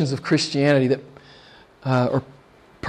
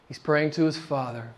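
normal talking voice

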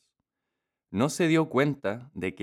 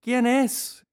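high calling granny voice; old woman calling; 3 dB louder than usual. A narrator's reading. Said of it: "gritó la mujer". (abuela que grita desde dentro)

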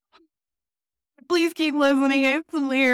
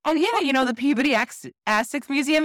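mock casual; 6 semitones higher than usual. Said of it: "looked at you and went".

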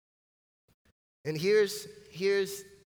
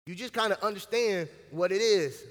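usual speaking voice

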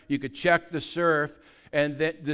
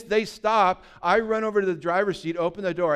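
ordinary talk, nothing marked

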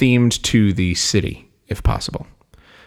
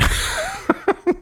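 high falling laugh; burst of laughter. Real, not acted. Laughing at guessing filet mignon for Vegas, high and falling. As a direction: laugh at your own answer.

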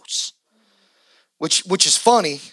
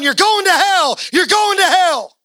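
mock condemning yell; 12 semitones higher, 4 dB louder than usual. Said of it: "we're going".

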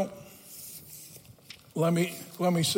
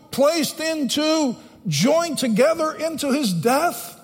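higher-pitched, slow, deliberate scripture exposition; emphatic preaching; 7 semitones higher, 3 dB louder, slower than usual. Real, not acted. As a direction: preach each phrase with weight.

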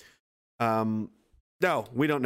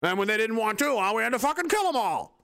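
mock official excuse; 7 semitones higher than usual. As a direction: sneer it like a self-satisfied official.

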